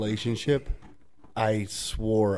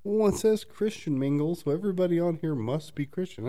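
high, trusting imitation; hammed-up gullible user; mock naive believer; 8 semitones higher than usual. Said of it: "people that are like".

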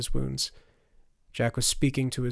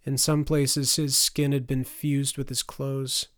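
ordinary delivery

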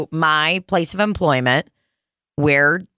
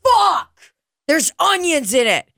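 husband's yelp at onions; disgusted outburst; 10 semitones higher than usual. Retold or imitated. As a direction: recoil from the bite in disgust. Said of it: "and he goes".

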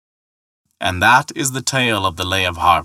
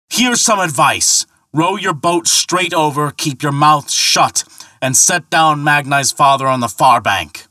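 great bellow; 6 semitones higher, 4 dB louder than usual. Acted. Thor bellowing orders at the ferryman across the Sound. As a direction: bellow it so it rings round the sky. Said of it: "he gave a great bellow".

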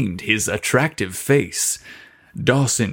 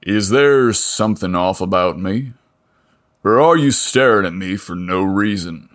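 rough voice; 3 semitones lower than usual. Acted. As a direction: lightly play the big mechanic, blunt. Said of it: "A rough voice spoke simply".

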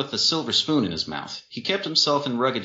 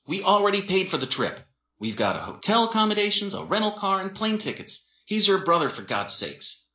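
exasperated; 11 semitones higher than usual. Acted. Dean arguing with Jen.

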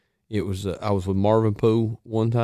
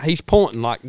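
ordinary talk

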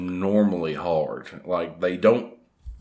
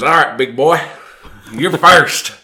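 gruff host voice; 6 semitones higher, 10 dB louder than usual. Retold or imitated. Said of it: "he looks at me and goes".

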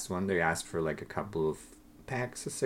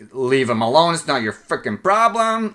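loud, much higher mock scolding; a sister defending the rude man, shouting; angry defensive yell; 7 semitones higher, 9 dB louder than usual. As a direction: snap back to protect him. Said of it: "kinda going like".

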